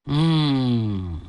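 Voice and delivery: long, low, falling "hmm"; pondering hum. A narrator's reading. Plotter wonders where to find troops, low pondering hum. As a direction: hum slowly and low while thinking it over. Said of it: "心里琢磨".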